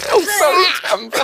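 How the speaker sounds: laughing. Acted. Laughing after wet laugh.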